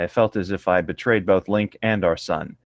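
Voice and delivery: ordinary delivery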